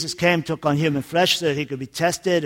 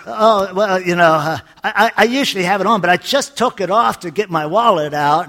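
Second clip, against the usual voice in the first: fast, stumbling, louder excuse; caught-out husband babbling fast; flustered excuse; 3 semitones higher, 4 dB louder than usual. Acted. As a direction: rush the excuse out, tripping over words. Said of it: "he says".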